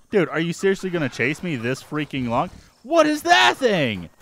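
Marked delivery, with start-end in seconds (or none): 2.8-4.1 high alarmed question. Real, not acted. Sees a weird creature.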